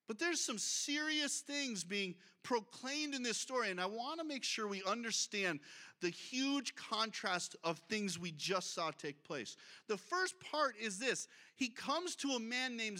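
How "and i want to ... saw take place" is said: ordinary delivery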